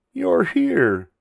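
high-pitched duck character; high storybook exclamation; Duck's voice. Acted. Duck greets the friends.

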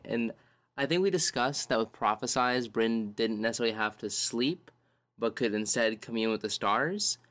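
usual speaking voice

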